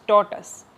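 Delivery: टॉर्टस with the weight on टॉ; stress on first syllable. Shown as a demonstration. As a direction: lean on the opening टॉ of टॉर्टस. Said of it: "उसके ऊपर लगा है primary stress".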